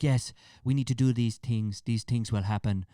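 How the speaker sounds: light Irish accent impression, imitating Conor; a lightly voiced Irish fighter impression. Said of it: "I promise you my Irish accent is quite good".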